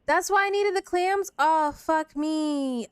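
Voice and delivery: sudden loud dismay, then a slow groan; high burst then drawn-out curse; dismayed realization. Real, not acted. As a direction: caught out by her own mistake. Realizes she sold needed clams.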